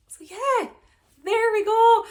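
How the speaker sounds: high, loud sing-song burst; excited. Real, not acted. Vlogger cheering her own update, high and loud. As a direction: squeal it out as a gleeful sign-off.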